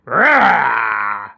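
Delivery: mock growling roar; wolf roar. Wolf springing at the girl, mock growling roar. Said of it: "the wolf leapt up and growled".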